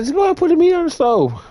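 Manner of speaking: high, fast mock girlfriend; imitated brush-off. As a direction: rush it out in her high-pitched voice. Retold girlfriend brushing him off.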